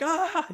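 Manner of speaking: sudden, very high, loud yelp with a laugh; laughing mock shriek of alarm; mock freak-out yelp. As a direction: jump and shriek like you've desecrated something sacred. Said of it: "we'd start freaking out".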